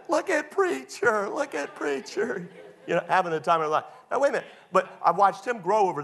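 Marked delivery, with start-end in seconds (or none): 0.1-2.5 put-on kids teasing. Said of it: "they're all laughing".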